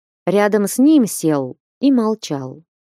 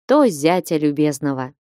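plain reading voice

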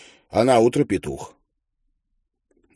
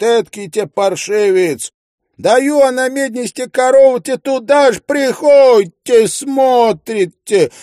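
very high, loud peasant screaming; shrieking servants; 12 semitones higher, 6 dB louder than usual. A narrator's reading. Servants scream threats at the master, very loud and high. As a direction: yell threats across the yard. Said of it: "кричит".

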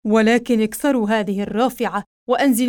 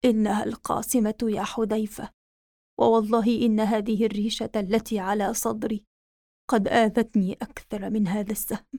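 put-on very old faint voice; 4 dB quieter than usual. Dying prince's last words.

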